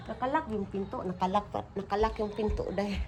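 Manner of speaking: ordinary delivery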